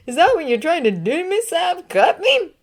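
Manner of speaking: high, fast feigned alarm; mock shock. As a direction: play up fake horror.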